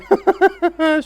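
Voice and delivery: high, loud burst of laughter; nervous laugh. Real, not acted. Fan startled by his keeper, high nervous laugh.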